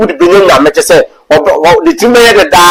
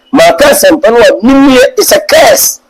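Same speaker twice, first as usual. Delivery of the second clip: impassioned cry; 10 semitones higher than usual. Real, not acted. Preacher stresses duty to preach.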